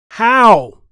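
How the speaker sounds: outraged how. Real, not acted. Reacting to the news clip, shouting in outrage. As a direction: demand an answer in outrage.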